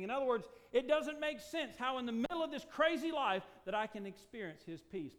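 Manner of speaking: loud, forceful preacher voice, slowed for weight; preaching. Acted. Preacher pressing the congregation on rest, forceful preaching. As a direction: drive the point home to the congregation.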